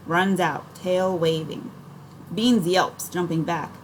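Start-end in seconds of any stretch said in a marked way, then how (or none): none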